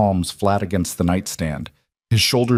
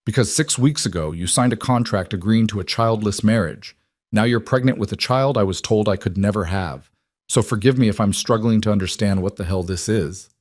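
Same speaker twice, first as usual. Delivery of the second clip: ordinary delivery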